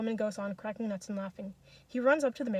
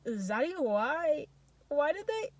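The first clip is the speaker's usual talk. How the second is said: put-on high, broken child's wail; tearful, drawn-out cry rising to a loud peak; sobbing boy; 7 semitones higher, 9 dB louder, slower than usual. A narrator's reading. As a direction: wail it haltingly, climbing higher. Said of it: "he sobs".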